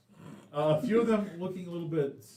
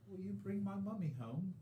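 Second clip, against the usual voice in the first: put-on little girl voice; 5 semitones higher, 9 dB quieter than usual.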